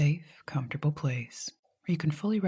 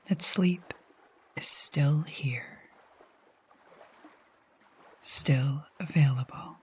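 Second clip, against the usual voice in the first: calm meditation voice with long pauses; guided sleep hypnosis. Acted. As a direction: guide them gently to sleep, leaving long silences between phrases.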